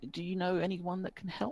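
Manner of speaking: put-on high, hesitant boy's voice; a nervous child asking strangers; young boy. Timid boy asks strangers at the inn.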